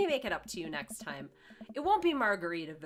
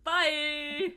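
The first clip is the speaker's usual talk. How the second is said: high, louder singsong farewell; playful parting call, pitched up; called-out goodbye; 7 semitones higher, 5 dB louder than usual. Acted. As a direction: call it back as you walk away. Acting Joseph calling while walking away.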